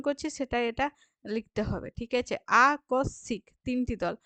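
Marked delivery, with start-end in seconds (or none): 2.7-3.4 আকস্মিক as আ, কস, সিক, m silent; spoken pronunciation. Shown as a demonstration. Teacher splitting the spoken form, ending in "sik". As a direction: end আকস্মিক in "sik", not "mik". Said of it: "আমরা সিক লিখব".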